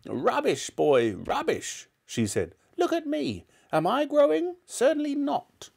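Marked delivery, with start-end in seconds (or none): 0.1-1.9 put-on high, dismissive old-woman voice; scornful grandmother. A narrator's reading. 2.8-5.7 very high, indignant granny voice; indignant old woman, pitched right up; scornful grandmother. A narrator's reading. Grandmother dismissing the boy.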